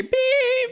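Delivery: sharp high beep like a truck reversing; high beeping noise. Retold or imitated. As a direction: mimic a reversing alarm, loud and high. Imitating reversing beeper sound.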